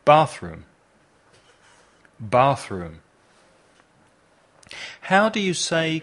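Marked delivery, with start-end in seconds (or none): 0.1-3.0 BATH-room, strong first syllable, weaker room; stress on first syllable. Shown as a demonstration. Teacher giving bathroom to repeat, stressed firmly on BATH. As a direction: hit BATH hard in bathroom. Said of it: "poner el énfasis en la primera sílaba".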